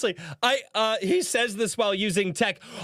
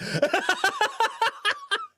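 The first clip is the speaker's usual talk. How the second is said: long, high, real cackle; helpless laugh. Real, not acted. Streamer cracking up at the commenter, long high laugh. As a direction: burst into a high laugh and keep going.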